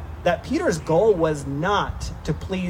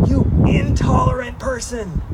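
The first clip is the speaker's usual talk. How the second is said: mock accusation; 5 semitones higher, 4 dB louder than usual. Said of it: "started attacking him and saying".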